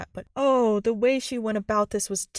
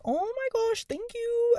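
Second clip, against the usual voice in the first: squealing much higher thank you; ecstatic Sabrina; 14 semitones higher, slower than usual. Retold or imitated. Sabrina thanks Zoe, gushing in a squealing high voice. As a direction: gush with delight. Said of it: "She's like".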